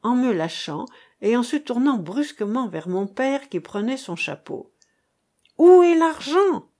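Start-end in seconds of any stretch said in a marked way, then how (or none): 5.6-6.7 high, loud repeated shout; repeated cry. A narrator's reading. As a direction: hammer the demand home. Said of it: "répéta-t-elle".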